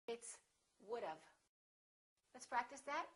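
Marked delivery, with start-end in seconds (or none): 0.9-1.2 "would've" said as the wrong version. Shown as a demonstration. Teacher demonstrates the error "would've". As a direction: say "would've" to show the mistake. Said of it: "Don't say would've".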